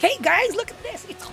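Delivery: high-pitched, over-hyped video intro; excited clickbait creator. Acted. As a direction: gush like a clickbait content creator. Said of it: "like one of them excited, um, clickbait".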